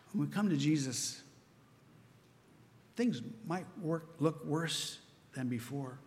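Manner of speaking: ordinary delivery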